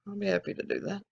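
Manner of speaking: ordinary delivery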